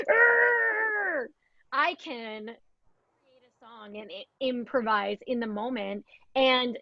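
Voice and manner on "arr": high, loud mock wail of a crying child; sudden cry of frustration; imitated child's cry. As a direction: shout it out like a frustrated toddler. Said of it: "they're crying and they're going".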